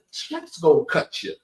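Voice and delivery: ordinary delivery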